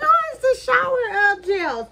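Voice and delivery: high, bright retold surprise; a mock-delighted discovery; amused realization. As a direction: replay the moment you realised it was shower gel. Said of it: "And I was like".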